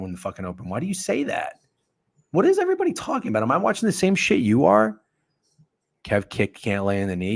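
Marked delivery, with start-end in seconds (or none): 2.3-5.0 high, fast panicked question; high-pitched fast what is everybody talking about; alarmed. Real, not acted. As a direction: blurt it in sudden alarm. Alarmed at chat.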